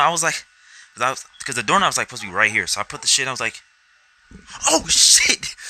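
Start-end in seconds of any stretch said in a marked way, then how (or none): 4.7-5.5 re-enacted yell, high and loud; shocked outburst. Telling of finding the doorknob wrong, shocked outburst. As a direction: shout it as a sudden alarmed discovery.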